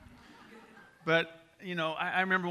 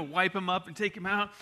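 ordinary delivery

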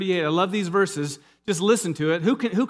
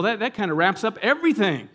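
ordinary talk

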